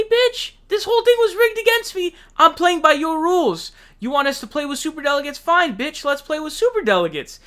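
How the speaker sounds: high, loud, exasperated squeal of a voice; mock outraged Bernie. Mocking Bernie telling the DNC off, in a high, shrill squeal. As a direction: ham up Bernie yelling at the party that rigged it.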